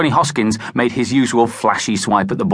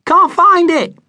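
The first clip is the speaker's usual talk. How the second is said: very high, loud shout; boy shouting from the meadow; 7 dB louder, slower than usual. A narrator's reading. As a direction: call from the far edge. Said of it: "shouted Tiddler".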